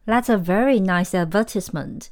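British sentence with ad-VER-tis-ment for "advertisement"; British pronunciation. Shown as a demonstration. Teacher giving an example sentence, British accent. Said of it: "英国人说的是advertisement".

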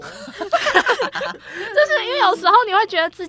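high, loud laughing words, slowed; high laughing confession. Real, not acted. Laughs at her own gloom.

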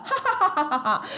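quick high villain cackle; gloating laugh. Acted. Demon Taoist laughs at the furious king. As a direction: laugh in cruel delight. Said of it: "反而哈哈大笑起来了".

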